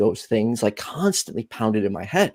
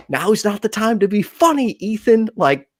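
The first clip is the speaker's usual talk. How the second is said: self-scolding; 9 semitones higher, 4 dB louder than usual. Retold or imitated. Scolding himself mid-laugh.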